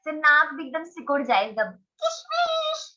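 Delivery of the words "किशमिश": "किशमिश" tiny, high and slow; squeaky mouse voice. Shown as a demonstration. Teacher demos the raisin word with a scrunched face, mouse-like. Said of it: "जैसे चूहे की आवाज है".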